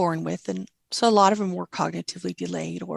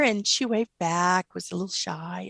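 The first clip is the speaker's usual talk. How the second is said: normal talking voice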